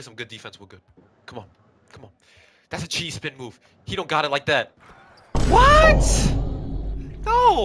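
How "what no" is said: shouts in disbelief; a sudden stunned yell; shocked shout. Real, not acted.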